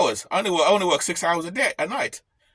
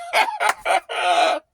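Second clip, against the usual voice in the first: high laugh; 4 dB louder than usual. Real, not acted. Laughing at his own repo-man joke with a high burst of laughter.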